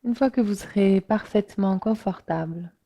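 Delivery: unhurried meditation voice with long pauses; guided relaxation. Real, not acted. Yoga teacher inviting listeners to let the body sink, guided relaxation. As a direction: guide listeners gently into rest, letting phrases settle.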